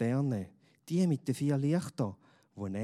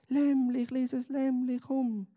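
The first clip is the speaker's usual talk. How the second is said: hopeful coaxing; 14 semitones higher, slower than usual. A narrator's reading. Boy coaxing his lost lamb, high child voice, hopeful. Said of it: "lockt der Simon hoffnungsvoll".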